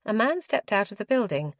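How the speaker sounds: ordinary delivery, nothing marked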